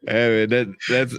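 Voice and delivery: normal talking voice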